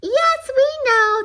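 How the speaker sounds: slow, high, loud chorus-like shout; shouted back. A narrator's reading. Donuts answer the baker, shouting. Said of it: "the donuts shouted back".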